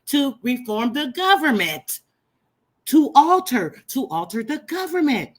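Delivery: rising high, impassioned reading; fiery preaching. Acted. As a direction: preach it to the crowd. Preaching the document.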